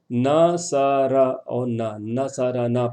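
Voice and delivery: slow نہ سارا نہ, syllables separated then joined as na-sa-ra-naa; measured sound lengths. Shown as a demonstration. Teacher showing how to read it, نہ سارا نہ syllable by syllable. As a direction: draw out each vowel of نہ سارا نہ. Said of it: "پڑھنا ہے آپ کو".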